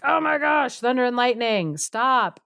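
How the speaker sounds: high-pitched theatrical annoyance; mock exasperated. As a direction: act out a fed-up ogress complaining. Ogress fed up with the helper.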